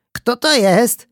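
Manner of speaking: put-on high, loud child's question; voiced child asking who's there; curious child. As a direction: pipe up like an excited little kid. Curious child asks about newcomer.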